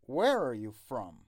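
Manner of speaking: "where" punched loud, "are you" slipped in unstressed; stress on where and from. Shown as a demonstration. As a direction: lean on "where" and "from", glide over "are you". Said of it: "are you رو نباید با استرس بگین".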